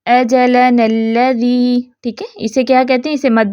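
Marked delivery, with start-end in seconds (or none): 0.1-1.8 "اجلنا الذی" recited very slowly on a level tone, the two words joined; Quranic example reading. Shown as a demonstration. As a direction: recite "اجلنا الذی" measured, "نا" short into the doubled lam. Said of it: "تو کیسے پڑھنا ہے". ("اجلنا الذی" ٹھہر ٹھہر کر ملا کر تلاوت)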